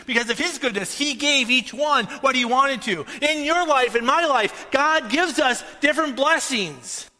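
high, loud, emphatic preaching; fervent pulpit delivery. Real, not acted. Preaches at raised intensity.

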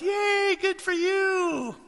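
squealed, very high congratulations; high, slow, overdone "yay"; mock cheer. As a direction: drag it out in forced falsetto delight. Mock cheering a second check.

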